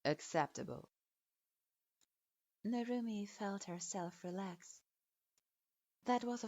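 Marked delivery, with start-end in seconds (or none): 0.0-0.9 put-on low, curt old teacher grading a student; a grudging low verdict; stern sensei voice. A narrator's reading. Strict sensei grudgingly approving his student, low and curt.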